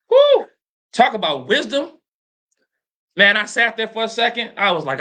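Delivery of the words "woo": high, loud whoop of amazement; excited whoop. Real, not acted. Husband whooping at his wife's wise words, loud. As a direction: let out a big whoop.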